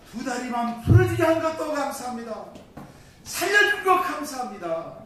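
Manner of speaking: loud, high fervent thanks to God; acted-out prayer bursting with thankfulness; overcome with gratitude. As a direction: cry out thanks at full voice. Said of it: "너무 감격스러워가지고".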